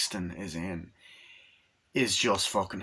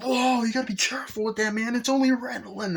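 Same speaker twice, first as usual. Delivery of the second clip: very high put-on voice; imitated alarmed salesman; 13 semitones higher, 4 dB louder than usual. Car salesman warns his driver, hammed-up alarm. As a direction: squeal it out, hammed-up panic. Retold character's caution while driving.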